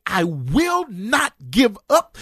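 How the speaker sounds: high, rising preacher's cry; fired-up preaching voice. Real, not acted. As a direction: preach it full force, building. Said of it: "put my preaching voice on".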